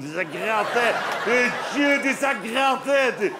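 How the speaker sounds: high, loud exasperated groan of itching. Retold or imitated. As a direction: wail it out, still itching from the wool. Itchy wool sweater complaint.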